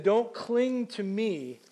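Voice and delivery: Don't cling to me, each word stretched slowly; slow, drawn-out Jesus line. Acted. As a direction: lean into it, slow and deliberate. Jesus's words acted out, slowed.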